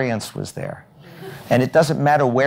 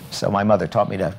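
usual speaking voice

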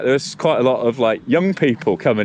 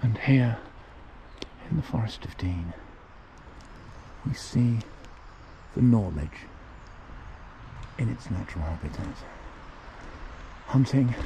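nature documentary; 12 dB quieter than usual. Acted. Mock wildlife voice-over while riding.

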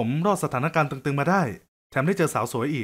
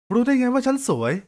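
surprised question; 9 semitones higher than usual. Acted. Woman catches his slip.

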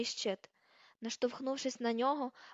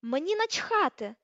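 high, loud, drawn-out dismissal; furious, stretched-out cry; angry shriek; 5 semitones higher, 6 dB louder, slower than usual. A narrator's reading. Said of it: "верескнула вона".

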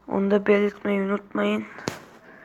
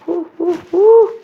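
rising hoots, getting much louder and higher; rising excited hoots; excited hooting; 9 semitones higher, 11 dB louder than usual. Real, not acted. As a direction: whoop it up like a goal is coming. Cheering during a football game.

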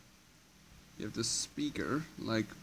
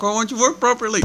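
high, loud, fast exasperated plea; pleading with a machine; 12 semitones higher, 13 dB louder, faster than usual. Real, not acted. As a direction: beg the broken TV to work. TV won't work properly.

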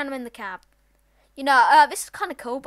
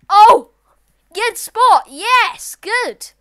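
high, loud cheering; excited cheer; 9 semitones higher, 16 dB louder, much slower than usual. Real, not acted. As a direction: cheer them on loudly. Player reaches the spot.

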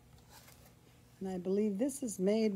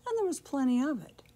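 usual speaking voice

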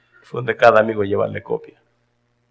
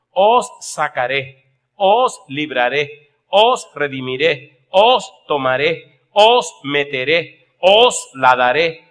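slow, louder, stressing every verb; emphatic preaching; 4 semitones higher, 5 dB louder, slower than usual. Acted. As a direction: proclaim each promise slowly. Preaching God's promises. (predicación lenta y enfática)

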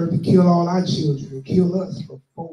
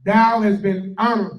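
scripture reading; 3 semitones higher, 4 dB louder than usual. Acted. Reads scripture aloud.

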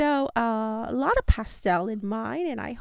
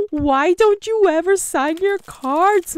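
normal talking voice

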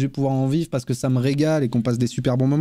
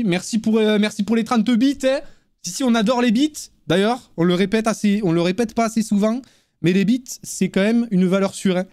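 light mock southern French accent, raised pitch; 7 semitones higher than usual. Thanking viewers for bits in mock southern French accent. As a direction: lightly put on a Marseille-style southern accent. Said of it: "je sais pas si je fais bien l'accent du Sud".

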